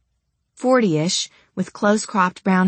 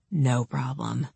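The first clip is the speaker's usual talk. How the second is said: lower, softer menacing snarl; 5 semitones lower, 7 dB quieter, slower than usual. Acted. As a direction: growl it low at her. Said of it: "he snarled".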